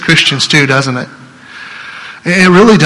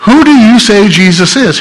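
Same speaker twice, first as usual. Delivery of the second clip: higher, louder ringing question; emphatic question; 6 semitones higher, 4 dB louder than usual. Acted. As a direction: hurl the question at the room. Rhetorical question to congregation.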